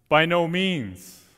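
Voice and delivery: slow, firm and weighty; solemn emphatic denial from the pulpit. Retold or imitated. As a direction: stretch it out as a flat refusal. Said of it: "emphatic response from Paul".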